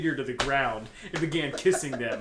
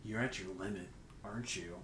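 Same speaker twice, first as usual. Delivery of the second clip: much softer, lower teasing tone; mock seductive murmur; 6 semitones lower, 13 dB quieter than usual. Reading the lewd line, mock seductive and soft. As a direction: lean in and purr it.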